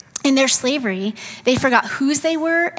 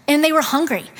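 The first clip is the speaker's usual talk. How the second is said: usual speaking voice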